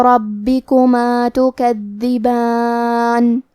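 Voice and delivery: slow, melodic tajweed chant; Quran recitation. Shown as a demonstration. Teacher reciting the verse to students, slow melodic chant. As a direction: draw each vowel out in measured recitation.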